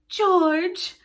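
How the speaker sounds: high, loud pained cry; pained exclamation. Acted. Missus Darling to her husband, pained exclamation. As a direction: exclaim his name, pained. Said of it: "Missus Darling exclaimed, pained".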